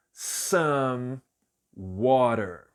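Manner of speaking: "Some" then "water" split apart and modelled; English demo. Shown as a demonstration. Teacher splitting "some water" into words for learners. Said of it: "eu vou dizer some water".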